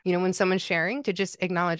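ordinary talk, nothing marked

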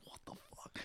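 whispered curse, voice dropped to almost nothing; muttered aside. Retold or imitated. Author cursing under his breath while muted, whispered. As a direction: hiss it so the call can't hear.

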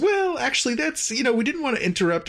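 high, squirming evasive voice; evasive captain. Retold or imitated. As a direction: pitch up, dodging the question.

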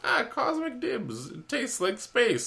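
high, slow cartoon mascot voice; cereal mascot. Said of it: "sounds like a weird cereal mascot".

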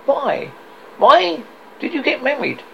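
high, sharp questioning; demanding Scrooge. Acted. Scrooge asks his nephew about marrying, high demand.

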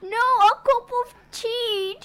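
put-on high, slow British granny accent; British grandma. Grandma correcting her grandson about tea. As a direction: correct him in a slow old British lady voice. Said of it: "She's British".

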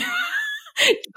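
a sudden high laugh of nervous delight; giddy nervous laugh; high burst of laughter. Real, not acted. Laughing at her own fear.

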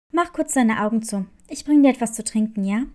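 low nurse, briskly reassuring. Acted. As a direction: drop low and hurry the offer of a drink. Nurse soothes Clara.

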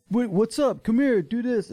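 high mocking pushy voice; mock the pushy guys, high.

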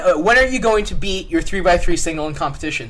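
ordinary talk, nothing marked

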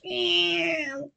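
long high drawn-out meow; a high cat cry before the pounce; cat screech. Acted. Cat-woman lunging at the officer, long high meow.

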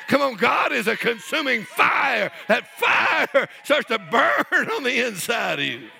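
loud, very high shouted sermon; full-force preaching. Real, not acted. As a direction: cry out with mounting fervor.

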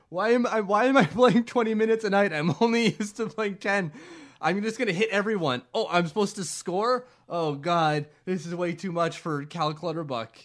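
high, loud, laughing bewildered player; overwhelmed hockey player, hammed up, laughing; mock panicked player. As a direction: ham up a player out of his depth. Player voiced, panicked, in a joke.

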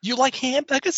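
mock Wahlberg question. Wahlberg bit continues.

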